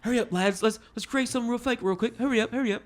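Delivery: high, quick mock British lads rushing; hammed-up Brits hurrying each other along; a playful take on a British bandmate; mock British band. Mocks the British band naming.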